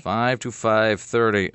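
drawn out, word by word; slowed for note-taking. Real, not acted. Host repeating guest's airtime while writing it down, very slow. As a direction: repeat it slowly as you jot it down. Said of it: "I'm just writing this down".

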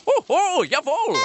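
very high, loud German clown voice; penis clown Nazi voice. Pretend. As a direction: squeal it like a silly German clown. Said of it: "he'd come out and go".

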